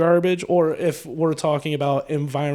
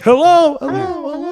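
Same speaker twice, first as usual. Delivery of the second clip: high calls fading like an echo; tiny bug calling into a huge echoing void; 11 semitones higher, 4 dB louder than usual. Retold or imitated. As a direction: call out as if lost in a metal chamber. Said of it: "their voice echoes in there".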